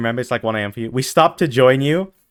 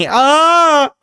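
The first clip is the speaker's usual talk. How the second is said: witchy shriek laugh; 14 semitones higher, 6 dB louder than usual. Pretend. High laugh after witch bit.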